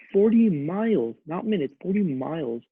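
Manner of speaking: "forty miles" hit high and slowly, each word weighted; emphatic stress. Real, not acted. Telling viewers how far he drove for her, emphatic.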